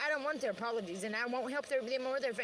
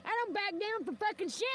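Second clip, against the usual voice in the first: ordinary talk, nothing marked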